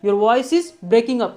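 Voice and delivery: slow and clear, each word spaced; slow model phrase. Shown as a demonstration. Teacher to students on a bad line, slow clear phrase. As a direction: drill it slowly for learners. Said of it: "या आप बोल सकते हैं".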